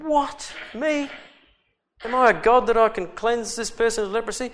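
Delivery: high, loud indignant cry; outraged king. Acted. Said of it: "rent his clothes and said".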